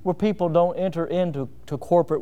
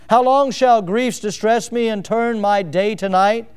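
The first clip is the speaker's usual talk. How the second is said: slow, louder reading of a psalm hymn, each line weighed; solemn hymn reading; 4 dB louder, slower than usual. Acted. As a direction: read the hymn verses with grave pleading. Reads the Psalter of 1912 psalm.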